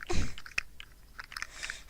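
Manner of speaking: breathy, voiceless laugh; apologetic laugh. Real, not acted.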